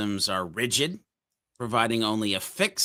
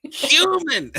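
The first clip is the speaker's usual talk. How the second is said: yelling; 8 dB louder than usual. Acted. Frustrated caller trying to escape the phone menu, yelling. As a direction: shout it like a fed-up caller. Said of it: "I'm the guy that yells".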